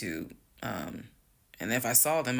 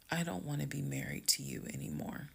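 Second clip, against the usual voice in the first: soft, nearly whispered breakup; calm; 7 dB quieter than usual. Retold or imitated. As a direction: tell him quietly and composedly. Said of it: "exactly how I was talking".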